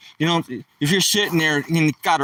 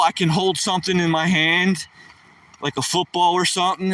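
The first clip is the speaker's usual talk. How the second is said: ordinary talk, nothing marked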